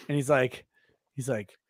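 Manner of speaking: ordinary delivery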